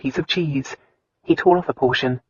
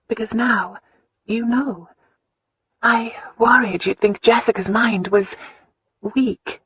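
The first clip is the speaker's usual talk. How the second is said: put-on higher, earnest young woman's voice; tender young wife; 7 semitones higher than usual. A narrator's reading. Wife opening her heart to her husband, higher.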